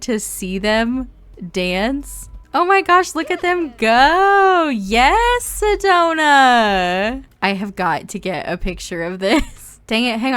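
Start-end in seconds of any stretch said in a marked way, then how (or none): 2.5-7.2 high, loud, gushing delight; squealing over her Sims dancing; thrilled squeal. Real, not acted. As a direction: cheer them on like a proud fan. Watching her Sims dance.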